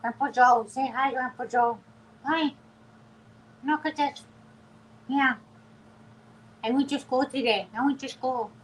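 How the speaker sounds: high, cutesy pet talk; pet's pretend voice. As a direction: speak for a small pet greeting Grandpa. Voicing small pet for Grandpa.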